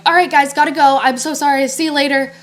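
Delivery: loud, high, rushed-exit voice; re-enacting a bright-loud excuse to escape; hurried goodbye. Telling the guys she's leaving, hurried loud goodbye. Acts out her hasty exit.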